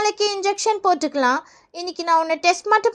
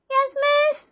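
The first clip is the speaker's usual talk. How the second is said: high schoolkid roll-call answer; reply in a little kid's voice; child pupil; 6 semitones higher, much slower than usual. Acted. Pupil answering the teacher's call, high child voice.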